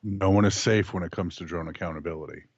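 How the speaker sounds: low, ominous announcement; creepy voice. Acted. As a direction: say it like a lurking killer. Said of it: "You said that in a very creepy way".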